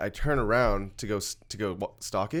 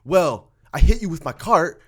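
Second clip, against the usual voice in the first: imitated accusing customer, higher and louder; still pissed off; 6 semitones higher, 7 dB louder than usual. Imitating the customer complaining about the look on his face, pissed off. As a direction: act out an indignant complaint. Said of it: "he's, like, still pissed off".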